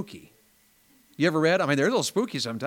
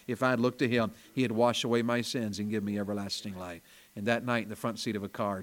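usual speaking voice

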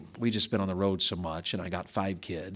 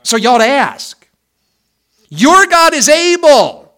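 loud, high, ringing revival preaching; full-force preaching; 13 semitones higher, 19 dB louder than usual. Acted. Pastor closing his sermon, preaching at full force. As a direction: drive the closing call home to the congregation.

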